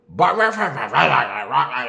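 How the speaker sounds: loud yappy bark-bark imitation; dog barking imitation. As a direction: bark like the caged dogs.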